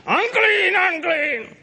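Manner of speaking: high wailing call of a leper; leper's cry. Retold or imitated. A leper crying a warning to others, high wailing call. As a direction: cry it out as an outcast. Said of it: "a leper is to cry out".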